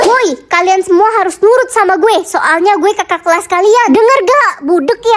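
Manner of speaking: loud, bossy scolding; fierce. Acted. Older student ordering juniors to obey, fiercely. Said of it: "Galak banget sih".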